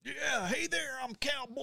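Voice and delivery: high, hammed-up cowboy drawl; Cowboy Rob voice. Introduced as Cowboy Rob, he greets in a high cowboy voice. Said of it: "we got Cowboy Rob".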